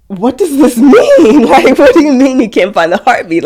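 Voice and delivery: high, loud, incredulous questioning; disbelieving outcry. Retold or imitated. Retells her distraught disbelief at news.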